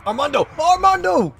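high, very loud yell building to a drawn-out wail; hammed-up desperate shout. Player calls after Armando as he leaves, over-the-top desperate shouting. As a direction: scream the name after him, stretching the last one. Calling after departing game character.

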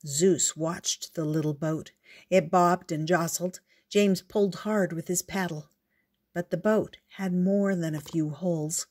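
ordinary delivery, nothing marked

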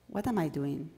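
ordinary talk

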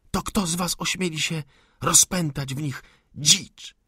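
high, loud accusing outcry; crying out in accusation. A narrator's reading. Girl hurling accusations at the insurgent, loud and high. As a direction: fling the words in his face. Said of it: "Rzuciła mu w oczy z krzykiem".

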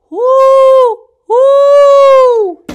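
high, loud owl hoots; loud playful owl call. Pretend. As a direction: hoot like an owl, long and full.

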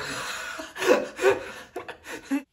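high breathy burst of laughter. Acted. Character laughs in skit.

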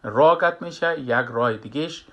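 ordinary talk

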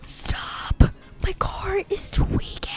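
unvoiced frantic whisper; hushed panic at a glitching car; panicked whisper. Real, not acted. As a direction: whisper it in alarm.

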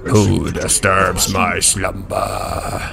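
slow, booming cave guardian; lion cave voice. Retold or imitated. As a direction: rumble it out like the Aladdin cave. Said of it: "the lion cave from, uh, Aladdin talking to you".

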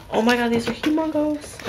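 high, delighted gush over the size; excited squeal. Real, not acted. Fan unboxing cards for viewers, high excited squeal. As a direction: squeal with delight at how big they are.

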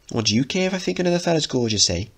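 fast, high, cheeky comeback; sharp teen retort. Acted. Linda throws it back at Mickey, fast and high. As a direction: fire it back at him.

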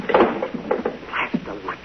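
very slow tense whisper; menacing slow whisper from the doorway. Acted. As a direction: hiss the order low in the dark. Intruder enters dark room.

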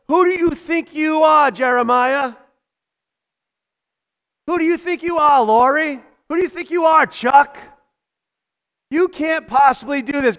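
high, loud accusing taunts; critics yelling. Retold or imitated. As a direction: hurl the doubt at each name. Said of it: "and they're yelling".

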